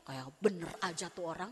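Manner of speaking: low, soft sulky muttering; resentful grumble. Retold or imitated. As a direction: grumble it under your breath, offended. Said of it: "Jangan bilang begini". (gerutu kesal, rendah dan pelan)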